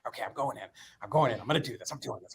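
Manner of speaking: soft, under-the-breath pep talk; imitated self-talk. Man psyching himself up driving to the event, hushed self-talk. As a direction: psych yourself up quietly, talking only to yourself. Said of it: "you know, self-talk".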